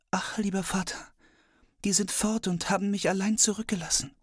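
put-on high, breathy whisper of a small girl; little girl voice. Daughter answers her father.

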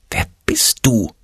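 slow, hushed whisper. A narrator's reading. Ole questions the unknown little voice, whispering. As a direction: whisper the question back cautiously. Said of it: "flüsterte er leise zurück".

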